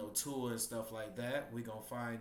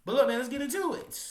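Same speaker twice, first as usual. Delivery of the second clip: hyped up; 11 semitones higher, 12 dB louder, faster than usual. Real, not acted. Hyped intro to the video.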